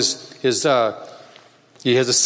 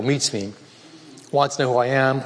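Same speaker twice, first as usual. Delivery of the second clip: usual speaking voice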